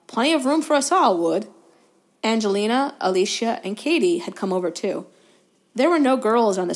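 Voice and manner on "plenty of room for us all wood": sly. A narrator's reading. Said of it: "trollish cunning".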